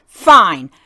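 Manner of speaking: put-on loud, huffy little-girl voice with an eye roll; grudging kid, loud and put-upon; sulky toddler. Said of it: "she kinda rolls her eyes".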